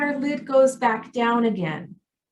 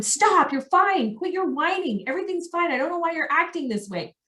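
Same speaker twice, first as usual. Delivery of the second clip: high-pitched exasperated scolding, not truly shouted; a parent snapping at a crying child, pitched up; mock scolding parent; 7 semitones higher than usual. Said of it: "by yelling and screaming and telling them".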